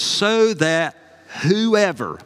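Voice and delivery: so that whoever, drawn out very slowly and savored; savoring the verse slowly. Shown as a demonstration. Preacher relishing the word whoever, slow and savoring. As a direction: linger on so that whoever. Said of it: "I love words like this".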